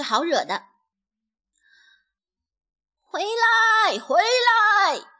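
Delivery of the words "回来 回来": high, drawn-out calling shout; loud shout. A narrator's reading. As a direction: call them back from far off. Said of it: "皮迪克大声喊道".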